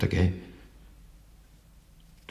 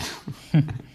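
put-on high cackling laugh at the old trick; a sly poacher's chuckle, pitched high; gleeful laugh; 11 semitones higher than usual. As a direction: laugh up high, pleased with yourself. Poacher recalls fooling the baron.

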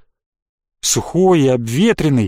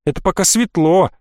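plain reading voice

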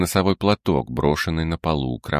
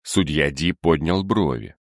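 ordinary delivery

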